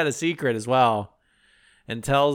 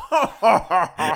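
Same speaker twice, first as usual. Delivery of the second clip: put-on ho-ho belly laugh; mock Santa chuckling; imitated Santa laugh; 3 semitones higher than usual. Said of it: "him laughing, doing the".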